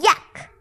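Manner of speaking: yuck. Acted. Disgust at salty soup.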